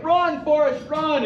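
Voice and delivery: high-pitched playground chant of the movie line; mock taunting shout. Classmates taunting him, a high mock shout. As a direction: yell it like kids teasing him. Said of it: "they're like".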